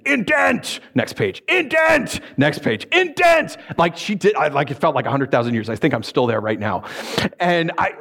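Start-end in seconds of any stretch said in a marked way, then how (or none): 0.1-3.6 imitating a furious second grade teacher; second grade teacher yelling at a student, mock screaming; retold teacher screaming. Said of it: "screaming at me".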